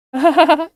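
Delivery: high, loud cackle of a cruel villain; shrill villain laugh. Acted. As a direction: burst out cackling at her pain.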